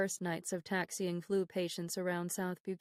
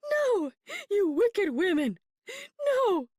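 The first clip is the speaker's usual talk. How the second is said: slow, high shrieking refusal; screaming; 13 semitones higher, 7 dB louder, slower than usual. Acted. Feverish mother shrieking at the Red Cross women, high and loud. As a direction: shriek it out, fighting them off. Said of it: "she screamed".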